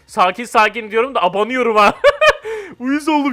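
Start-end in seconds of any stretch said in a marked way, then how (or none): 2.0-2.7 high, loud laugh at a blunder; a sudden high burst of laughter; laughing at own mistake. Real, not acted.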